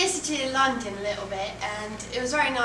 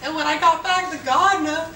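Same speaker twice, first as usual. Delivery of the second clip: put-on Southern accent; 6 semitones higher, 5 dB louder than usual. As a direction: ham up a Southern accent while giggling.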